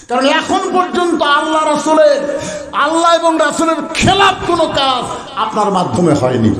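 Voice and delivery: high-pitched preacher's proclamation; impassioned declaration. Retold or imitated. Companions vouching for their Amir, proclaimed high.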